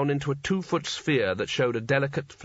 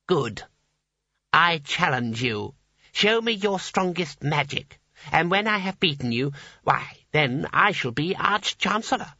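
put-on calm menacing boy; cold child challenger; 4 semitones higher than usual.

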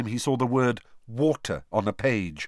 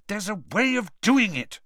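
higher, sharp and annoyed; cross; 10 semitones higher than usual. A narrator's reading. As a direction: insist crossly. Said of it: "Hermione said crossly".

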